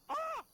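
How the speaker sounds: high-pitched startled squeal; imitation of a squeaky scream when shot at; squeaky yelp. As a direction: yelp loudly in a mocking squeak. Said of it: "don't make a squeaky noise like that".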